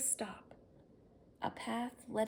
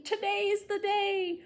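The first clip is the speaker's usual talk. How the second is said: excited shout; 11 semitones higher, 8 dB louder than usual. Acted. Harry excited before horn.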